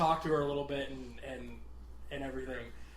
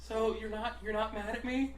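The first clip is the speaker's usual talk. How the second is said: higher, small hesitant question; imitated timid coworker; 6 semitones higher than usual. Coworker checking box guy isn't mad, imitated timidity. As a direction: ask meekly in a raised voice. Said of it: "like, about to cry".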